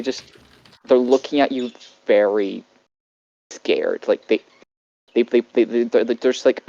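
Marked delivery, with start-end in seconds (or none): none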